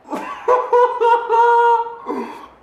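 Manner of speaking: long, very high quavering squeal of joy; overwhelmed high laugh. Real, not acted. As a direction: laugh as if about to cry. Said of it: "I could cry right now".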